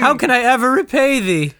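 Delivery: high, swooning damsel voice; hammy stage actor. Acted. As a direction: overplay a delighted damsel. Damsel greets her knight.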